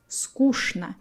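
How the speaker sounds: скучно with "sh" in place of ч. Shown as a demonstration. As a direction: say скучно as "skoosh-na". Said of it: "you can pronounce the word".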